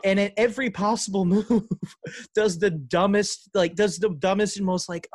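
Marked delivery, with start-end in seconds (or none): none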